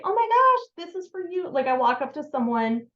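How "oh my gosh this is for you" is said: gushing surprise. Shown as a demonstration. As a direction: gush as if handing a stranger a gift. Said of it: "instead of saying ... I just say".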